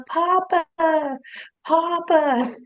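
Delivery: high, drawn-out call for Papa; dog as movie mouse. Retold or imitated. Wife voices the happy dog at the window, high little-mouse call. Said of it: "He was going, 'Papa".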